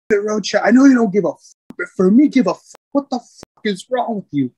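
high, mock-furious chewing-out; livid gunny. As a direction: imitate an enraged superior tearing into someone. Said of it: "my gunny was livid".

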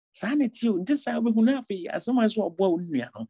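fervent sermon exhortation; full-force preaching. Acted. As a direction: drive the warning home from the pulpit. Pastor resumes exhortation.

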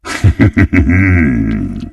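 low, rolling villain chuckle; low gloating laugh; sinister chuckle. Acted. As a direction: chuckle darkly, letting it tail off. Villain laughing between lines.

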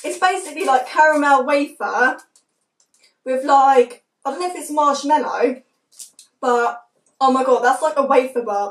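eating and talking; talking while chewing. Real, not acted. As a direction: mouth partly full. Seen 2: Holds bitten wafer bar; jaw and lip motions suggest chewing while speaking.

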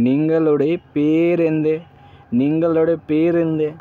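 নিঙ্গলোডে পেরেন্দে drawn out slowly for learners to copy; slow Malayalam demo. Shown as a demonstration. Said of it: "আপনার নাম কি?".

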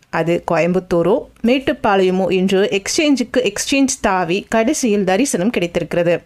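ordinary delivery, nothing marked

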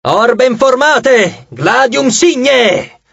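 high, drawn-out military orders; slow, ringing drill commands; commander's shout. A narrator's reading. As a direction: stretch each order out for the troops. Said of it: "gridò Reina".

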